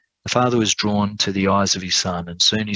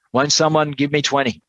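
auctioneer; 6 semitones higher than usual. A narrator's reading. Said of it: "continued the auctioneer".

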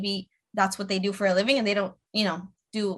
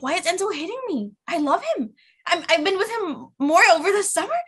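freaking out; 9 semitones higher than usual. Retold or imitated. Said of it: "freaking out, like, telling my husband".

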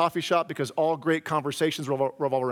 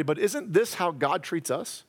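ordinary delivery

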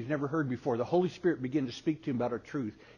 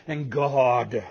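fake praise; 3 semitones lower, 4 dB louder than usual. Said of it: "You go, you go".

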